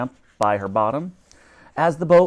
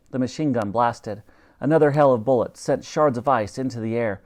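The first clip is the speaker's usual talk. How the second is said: ordinary delivery, nothing marked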